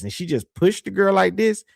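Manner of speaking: normal talking voice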